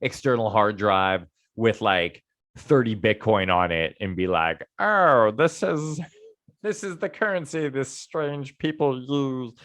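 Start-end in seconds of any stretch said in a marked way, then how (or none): 4.8-9.5 a lightly voiced slow alien. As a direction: speak like a puzzled alien, slowly. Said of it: "Nice alien voice".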